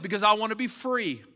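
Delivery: ordinary delivery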